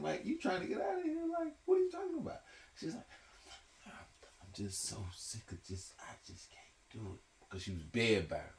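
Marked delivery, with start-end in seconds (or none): 0.0-2.4 upset pleading. Retold or imitated. Grandson upset at dying grandma. 3.9-7.2 weary grandma. Retold or imitated. Bedbound grandma tired of life.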